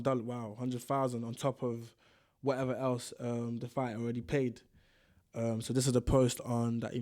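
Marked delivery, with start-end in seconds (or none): none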